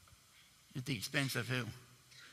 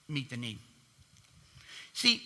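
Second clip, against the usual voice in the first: ordinary delivery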